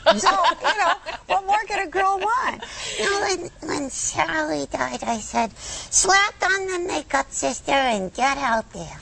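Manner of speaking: high, slow sassy puppet; brassy little lamb voice; Lamb Chop voice. Pretend. Puppet voice joking.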